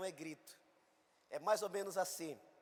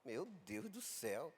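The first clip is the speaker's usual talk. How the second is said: "Meu Deus do céu" said low, soft and slow, no excitement; calm man; 6 semitones lower, 8 dB quieter, slower than usual. Retold or imitated. Pastor answering on the phone, "Meu Deus do céu" low and calm. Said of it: "Eu digo".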